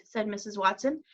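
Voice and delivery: ordinary delivery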